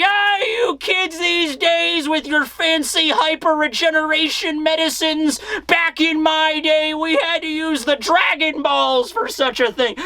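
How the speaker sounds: put-on high, slow cranky old-timer voice; creaky old geezer, slowed down; old prospector. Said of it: "grizzled prospector as an old man".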